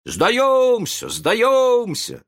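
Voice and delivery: high loud cry of giving up; shouted surrender. A narrator's reading. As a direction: shout it out together. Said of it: "закричали они". (пираты громко кричат «сдаёмся»)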